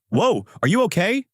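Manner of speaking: high, rising worried question; worried asking. A narrator's reading. Adano asks Sasaki after she vomits, worried. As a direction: ask with startled concern, pitched well up. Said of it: "making Adano ask worriedly".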